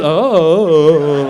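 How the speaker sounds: long vacant oh; oblivious boy zoning out; spaced out. Retold or imitated. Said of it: "boys get a little spaced out".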